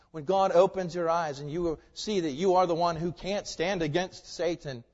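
ordinary delivery

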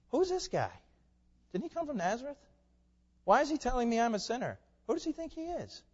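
high-pitched, offended questioning; mock indignation. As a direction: play an affronted listener querying a stranger's nerve. Voices offended synagogue listeners.

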